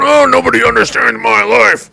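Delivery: high, loud mock lament of a put-upon parent; whiny angry parent. Mocking an angry parent who feels nobody understands him, loud and high. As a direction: wail it like a fed-up parent. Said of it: "angry at their kid and going".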